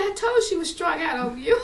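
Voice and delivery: high, loud crowing, imitating Mama; hilarious mother. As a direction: tease loudly in an excited mother's voice. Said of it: "she would laugh so hard".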